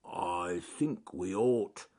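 slow, drawn-out bear voice; deliberate slowly, as if being clever; cleverly slow bear. A narrator's reading. Bear announcing his thought to his friends, slow and self-consciously clever. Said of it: "said Charles Growly Bear cleverly".

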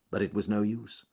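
usual speaking voice

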